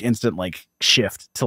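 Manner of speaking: usual speaking voice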